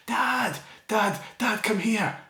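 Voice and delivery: put-on child calling out. Preacher imitating his son calling from the bath, high child's call. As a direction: mimic a little boy calling Dad from the bath. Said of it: "and he shouted".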